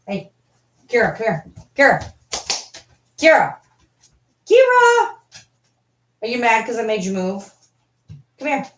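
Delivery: high-pitched pet-talk calls; coaxing dog. Real, not acted. Owner calling Kira to come here, high coaxing. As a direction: coax the dog with rising calls.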